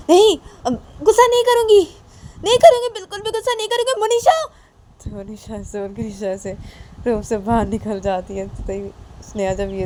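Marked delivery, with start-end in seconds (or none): 0.1-4.3 very high, loud shouted vow; vehement outcry. Acted. Nisha suddenly vows to stop being angry, high shouting. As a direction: cry it out, speeding up towards the end.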